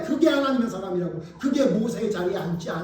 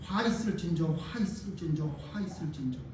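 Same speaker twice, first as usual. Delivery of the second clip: softer with each line; fading prayer refrain; 7 semitones lower, 10 dB quieter than usual. Real, not acted. Pastor praying before congregation, fading solemn refrain. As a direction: let the words die away in prayer.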